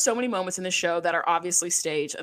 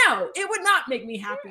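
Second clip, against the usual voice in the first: mock Abby; 4 semitones higher than usual. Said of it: "And Abby goes".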